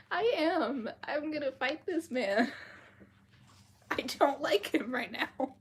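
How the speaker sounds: high, loud playful indignation; half-laughing, loudly worked up over a character; mock outrage. Real, not acted. Reader ranting to listeners about a disliked character, mock outrage.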